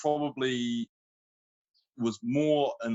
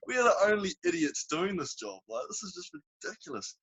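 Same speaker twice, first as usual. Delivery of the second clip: much higher, half-laughing disbelief; incredulous exclamation; 11 semitones higher than usual. Retold or imitated. To his workmates in the scorching mine, higher incredulous laugh. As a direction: tell the boys it's ridiculous, laughing at the absurdity.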